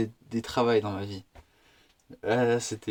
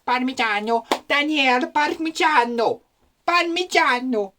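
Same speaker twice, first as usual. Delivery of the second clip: put-on high, drawn-out Italian accent; mock Italian chef; 10 semitones higher, 4 dB louder, slower than usual. Italian chef calling his trainee in the kitchen, hammed-up Italian accent. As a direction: call it out like an exuberant Italian chef, much higher and louder. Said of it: "tu sais, il est comme ça".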